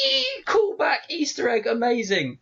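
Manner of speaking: high-pitched giddy squeal; excited squeal. Retold or imitated. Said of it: "absolutely squeal with excitement".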